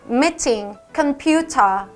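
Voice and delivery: British "meeting, computer" with full T, no final R; British crisp T. Shown as a demonstration. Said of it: "영국에서는 살려서". ("meeting, computer" 영국식, T 발음 살려서)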